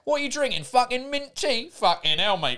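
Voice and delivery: cockney haut perché, incrédule; accent de l'est de Londres. Acted. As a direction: fais traîner la question en Londonien caricatural. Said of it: "Londres, euh, de l'Est en mode". (imitation londonienne exagérée)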